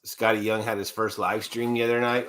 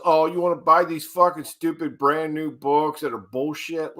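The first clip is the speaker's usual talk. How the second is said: higher-pitched mock of griping reviewers; mocking other channels; 5 semitones higher than usual. Co-host imitating rival channels' complaints, higher mocking voice. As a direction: parody a channel trashing new books. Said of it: "I hear some of these other channels".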